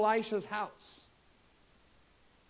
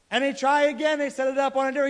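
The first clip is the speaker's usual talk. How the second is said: ordinary talk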